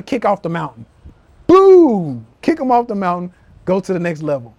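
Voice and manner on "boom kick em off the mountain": loud punchy shout, pitched up; shouted boom. Real, not acted. Firing up viewers to climb higher, loud shout. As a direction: blast it like a knockout. Hyping himself to next level.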